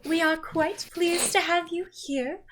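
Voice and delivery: a lady chiding her daughter playfully; theatrical mother. Acted. The mother speaks to guests.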